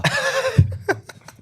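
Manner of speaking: very high squeal of laughter; laughing. Real, not acted. Podcaster amused by co-host's sympathy, high squealing laugh. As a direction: let out a high-pitched laugh.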